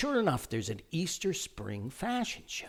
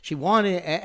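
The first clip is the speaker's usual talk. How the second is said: usual speaking voice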